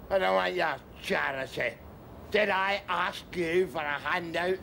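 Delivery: normal talking voice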